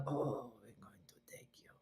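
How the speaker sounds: very soft whisper; breath-only acted quote; hushed mock casting choice. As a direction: whisper it low, as if smitten. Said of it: "And they were like".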